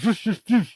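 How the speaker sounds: repeated high whooshing bursts by mouth; imitated rocket fire. Reviewer voicing Mechagodzilla's rockets, imitated whooshes. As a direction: mimic rockets launching one after another.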